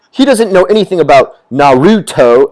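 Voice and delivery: loud, high, passionate imitation; hammed-up nerd outrage; mock angry nerd. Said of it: "so passionate".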